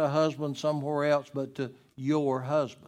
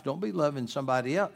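ordinary talk

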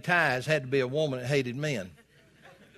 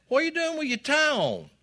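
high, scoffing jab at the tie; mocking question; 11 semitones higher than usual. Ministry buddy mocks his friend's tie, high and scoffing. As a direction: tease him about wearing a tie.

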